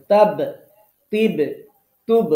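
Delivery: তাব, তিব, তুব with the final ব bounced out hard; তাব, তিব, তুব — শেষের ব শক্ত করে ধাক্কা দিয়ে; firm qalqala. Shown as a demonstration. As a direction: make the ব at the end of তাব, তিব, তুব rebound firmly. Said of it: "আরো একটু শক্ত করার চেষ্টা করবেন".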